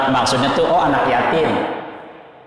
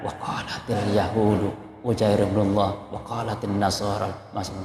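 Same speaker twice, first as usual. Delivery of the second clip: much softer, lower Quran reading; reciting a sensitive verse quietly, lowered; lowered recitation; 5 semitones lower, 9 dB quieter than usual. Shown as a demonstration. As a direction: drop your voice for this verse. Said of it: "harus sedikit rendah".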